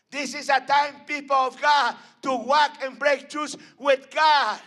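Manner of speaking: loud, high shouted preaching; preaching at full force. Acted. Preacher calls out to the people of God, shouting. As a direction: build to a roaring climax.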